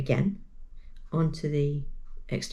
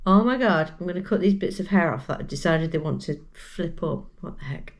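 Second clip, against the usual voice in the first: ordinary delivery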